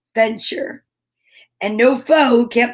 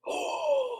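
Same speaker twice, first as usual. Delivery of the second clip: surprised gasp; 11 dB quieter than usual. Real, not acted. Surprise at vitamin color.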